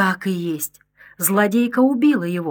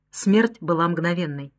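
ordinary delivery

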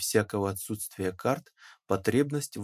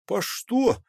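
blurted; 12 semitones higher, 5 dB louder than usual. A narrator's reading. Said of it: "опять выпалил Пахом Степаныч".

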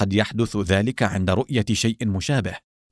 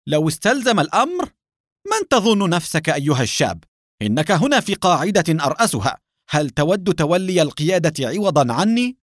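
high-pitched, affronted outburst; shouted interruption; 6 semitones higher, 3 dB louder than usual. A narrator's reading. Base chief challenging a young officer, crying out indignantly. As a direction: cut in indignantly, voice pitched well up. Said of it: "صاح الدكتور سامي مقاطعًا".

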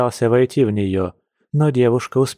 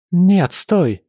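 high-pitched alarmed cry; panicked; 8 semitones higher, 4 dB louder than usual. A narrator's reading. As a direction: break it off in sudden alarm. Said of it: "в панике прошептала девушка". (в панике, высоким голосом)

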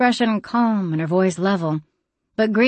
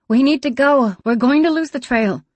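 urgent raised voice; shouting; 7 semitones higher, 4 dB louder than usual. Acted. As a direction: shout it, impatient to leave. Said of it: "Dima's voice rose to a shout".

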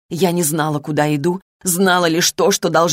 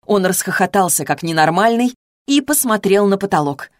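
usual speaking voice

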